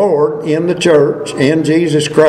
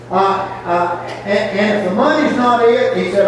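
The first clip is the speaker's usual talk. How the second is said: ordinary talk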